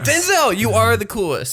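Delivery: high, gushing fan voice; gushing admiration. Acted. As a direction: gush like a starstruck fan. Gushing mock praise of movie star.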